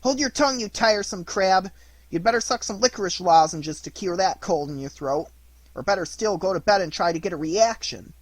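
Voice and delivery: loud, high rude jeer; mock the crab rudely; rude mockery. A narrator's reading. Pinocchio jeering at the crab, loud and rude. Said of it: "looked at him mockingly and said rudely".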